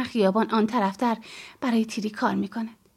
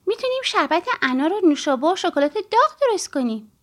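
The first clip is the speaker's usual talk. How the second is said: put-on higher, lilting child's voice; eager child; 5 semitones higher, 4 dB louder than usual. Child offering mother help with the party, eager. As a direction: pitch your plans like an eager little helper.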